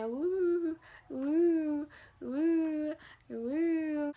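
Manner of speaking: soft, high, drawn-out woos; blissed-out woo. Retold or imitated. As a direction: float on painkillers, cooing softly. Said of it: "I felt so good".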